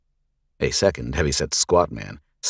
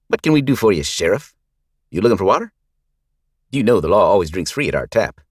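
mocking thug; 5 semitones higher, 7 dB louder than usual. Gang leader mocking the sheriff to his boys, loud sneer.

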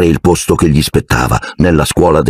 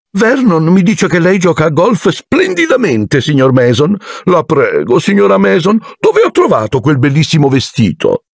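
fawning hostess; 11 semitones higher than usual. A narrator's reading. Petunia rehearses compliments.

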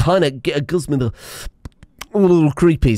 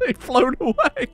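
much higher, laughing through the words; real incredulous laughter; laughing disbelief; 14 semitones higher, 5 dB quieter than usual. Real, not acted. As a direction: giggle it out, too amused to speak plainly. Ships leave without bestowers.

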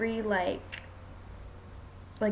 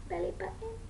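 much higher and softer coo; 10 semitones higher, 8 dB quieter, faster than usual. Real, not acted. Mom asking baby again.

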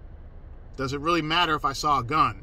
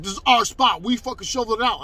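raised, loud territorial protest; higher-pitched, argumentative impersonation; imitated indignation; 6 semitones higher, 5 dB louder than usual. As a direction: mimic him angrily claiming the spot. Acted quote in parking quarrel.

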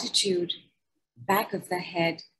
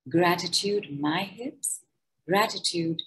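guided gratitude meditation. Acted. Leading listeners through a body gratitude decree, calm meditation voice. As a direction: guide them gently through each body part.

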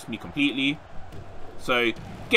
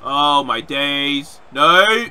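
drawn-out loud cry of dismay; 3 semitones higher, 10 dB louder, much slower than usual. Real, not acted. Gamer seeing his move go wrong, long loud wail. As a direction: stretch it out, then burst into a shout. Dismay at game, louder take.

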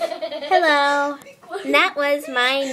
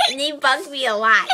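ordinary talk, nothing marked

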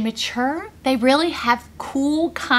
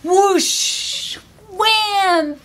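whoosh wham cheer; 8 semitones higher than usual. Shown as a demonstration. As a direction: call it out big and bright. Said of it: "So everyone go".

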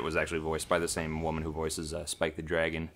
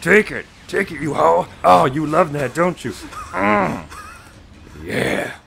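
mock sultry taunt; 8 semitones higher, 10 dB louder, slower than usual. Mock sex talk to game boss.

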